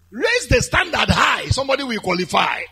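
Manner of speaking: loud, forceful preacher's exhortation; preaching shout. Real, not acted. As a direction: belt it out to the congregation.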